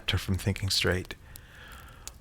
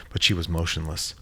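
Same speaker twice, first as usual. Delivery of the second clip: ordinary delivery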